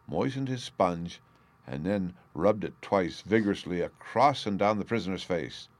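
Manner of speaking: plain reading voice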